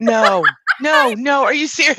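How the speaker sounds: gasping, high and loud, laughing in disbelief; real shock breaking into a laugh. Real, not acted. Cohost gasps at the baby-talk story, high and loud.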